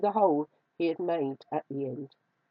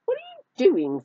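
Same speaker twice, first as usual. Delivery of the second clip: high, loud indignant kitten; storybook outrage, pitched high; angry kitten; 6 dB louder than usual. A narrator's reading. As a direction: demand it in a raised, cross voice. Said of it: "said the kitten angrily".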